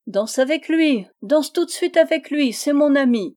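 loud, high, insistent urging; crying out. A narrator's reading. Yann urging Rose Eyre to dance with his friend, shouting. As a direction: call out an order over the dancing. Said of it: "cria Yann".

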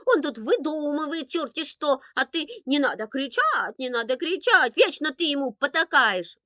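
loud, high-pitched tirade; outraged girl's rant; boiling indignation. A narrator's reading. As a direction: protest hotly to mother about unfairness. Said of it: "Виктоша уже кипела от возмущения".

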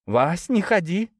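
mother's worried warning. A narrator's reading. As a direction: plead with husband not to go. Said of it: "предупредила мать отца".

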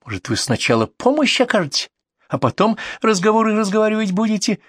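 high-pitched malicious nagging; spiteful. Acted. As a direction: snipe at the doctor, rising in pitch. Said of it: "злобно спросила соседка".

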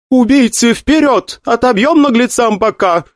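high rallying battle cry; commanding order. Acted. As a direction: urge the fighters forward. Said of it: "приказала наставница Сонг". (боевой приказ)

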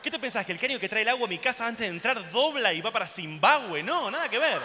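furious comic rant; loud rant. Acted. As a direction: yell it at the whole room. Ranting at ecologists.

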